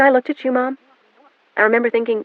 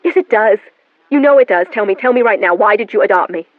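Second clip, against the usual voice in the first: louder, higher angry shouting demand; furious demand; 7 semitones higher, 9 dB louder than usual. A narrator's reading. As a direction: shout it at your mother, no more excuses. Daughter furiously demanding answer.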